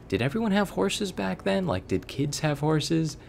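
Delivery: put-on high, eager little-kid question. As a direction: squeak it up like a curious kid. Retelling his own childish question.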